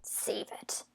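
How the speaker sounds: very soft, voiceless brush-off; hurt dismissal. Acted. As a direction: cut them off under your breath. Cuts him off while leaving.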